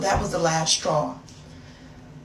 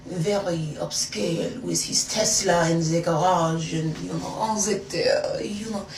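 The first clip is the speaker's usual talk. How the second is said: put-on French accent; 2 semitones lower than usual. Describing his fancy house, in a put-on French accent. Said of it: "I turned into a whole French woman".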